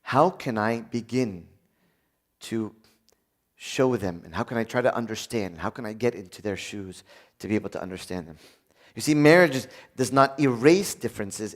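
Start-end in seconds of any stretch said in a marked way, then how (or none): 0.0-8.4 soft, low, patient inner voice; gentle self-talk. Shown as a demonstration. The preacher modelling soft patient self-talk for couples. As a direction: coach yourself gently into empathy.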